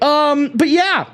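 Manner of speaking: normal talking voice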